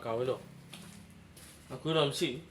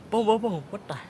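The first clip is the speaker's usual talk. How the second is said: sudden loud, high startled cry; real shock breaking out of him; startled outburst; 6 semitones higher, 6 dB louder, slower than usual. Real, not acted. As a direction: jump at a sudden fright. Startled by something in the dark.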